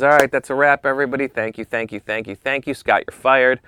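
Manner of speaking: loud, high, hammy boss announcing the wrap; booming wrap call. Retold or imitated. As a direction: call out the end of the shoot to the whole room. Boss ends shoot, fires him.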